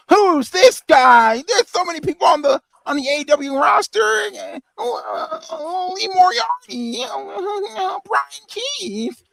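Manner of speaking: high, drawn-out mocking whine; mocking clueless fans. Co-host imitates clueless fans in the replies, high mocking whine. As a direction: put on a squeaky, dim-witted complainer.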